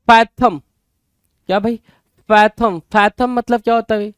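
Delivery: usual speaking voice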